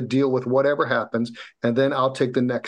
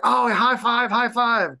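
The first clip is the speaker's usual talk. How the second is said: higher, eager imitation of the cheering trainer; imitating happy trainer; 8 semitones higher, slower than usual. The trainer celebrates a finished workout, imitated with excitement. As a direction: echo his delighted high five. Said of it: "He was all happy".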